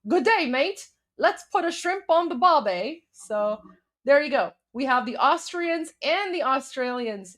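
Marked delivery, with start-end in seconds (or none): 0.1-3.0 mock Aussie greeting, pitched up; Jim Carrey. Retelling Jim Carrey's flirty limousine scene, mock Australian accent. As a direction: put on a playful higher Australian accent. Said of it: "Then he's like".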